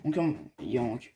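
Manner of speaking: ordinary delivery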